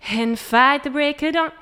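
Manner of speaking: sung without closure; breathy version. Shown as a demonstration. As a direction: let breath lead each word. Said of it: "Instead of".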